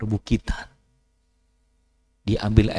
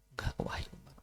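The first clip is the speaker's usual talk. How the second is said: hushed, ashamed whisper; whispered refusal in shame; 19 dB quieter, faster than usual. Acted. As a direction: barely breathe the refusal. Ashamed Sa'labah refuses Umar.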